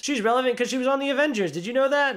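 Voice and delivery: high, rushed, sarcastic mock announcement; sarcastic parody. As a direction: sneer at the film's pandering. Parodying the movie's pandering.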